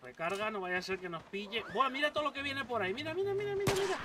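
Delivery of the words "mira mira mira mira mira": much higher, rapid repeats; excited alarm. Real, not acted. Gamer spotting a horde of enemies, calling out excitedly. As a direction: point out the incoming horde urgently.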